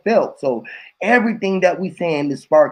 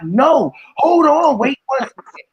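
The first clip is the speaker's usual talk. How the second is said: mock insistent interrupt; 10 semitones higher, 4 dB louder, slower than usual. Acted interruption in a debate.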